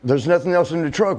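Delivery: bossy objection. Retold or imitated. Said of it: "the colonel was like".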